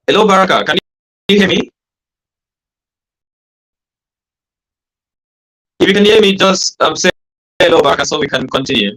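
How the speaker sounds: loud, high shout down the line; shouting to someone hard of hearing. Real, not acted. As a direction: call out as if across a room. Calling to a guest on a bad line.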